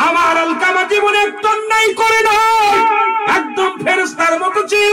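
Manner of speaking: high, loud, ringing sermon cry; full-force preaching. Acted. Preacher vouching for Alkama before God, full-force high plea.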